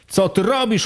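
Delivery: Co ty robisz? thrown up high as a challenge; booming shout. A narrator's reading. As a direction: call it out from behind, voice lifted high. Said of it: "huknął za pleców Michał".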